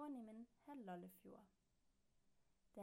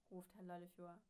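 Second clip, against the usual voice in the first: plain reading voice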